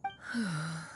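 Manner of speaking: a quiet, low sigh of sympathy; soft sigh. Acted. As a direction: sigh gently before speaking. Adeptus senses guilt, sighs softly.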